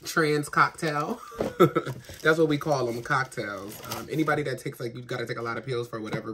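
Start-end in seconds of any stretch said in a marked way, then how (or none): 1.2-2.2 short laugh. Real, not acted.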